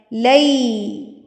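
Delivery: لئی with spread lips ending in an ee sound; smiling ee sound. Shown as a demonstration. Teacher showing the ya leen, لئی with a smiling ee. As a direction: say لئی slowly through a smile. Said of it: "smile کر کے".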